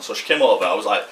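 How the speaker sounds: ordinary talk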